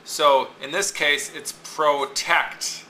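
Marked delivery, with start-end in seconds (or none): 1.5-2.8 protect drawn out slowly, TECT stressed; stress on second syllable. Shown as a demonstration. Teacher showing students word stress, slow protect with TECT stressed. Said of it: "The tect is the stressed part".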